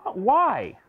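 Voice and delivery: put-on a child's startled why; surprised child question. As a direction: ask it in puzzled disbelief. Child voiced asking mommy.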